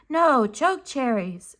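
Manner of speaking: put-on high, eager child's shout. A narrator's reading. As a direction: call it out high like an impatient little kid. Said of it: "Quill shouted".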